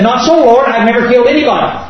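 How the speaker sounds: high, quick objection; pleading protest. Acted. Acted protest to the voice.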